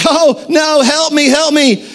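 high-pitched mock damsel in distress; Clarice. Girlfriend held up by the Bumble, mock panicked plea. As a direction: imitate a cartoon doe pleading for rescue. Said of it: "Rudolph's girlfriend, Clarice, and she's like".

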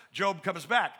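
normal talking voice

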